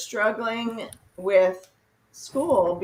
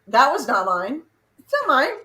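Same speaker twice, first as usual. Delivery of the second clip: put-on defensive teen; 5 semitones higher, 6 dB louder than usual. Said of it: "be like".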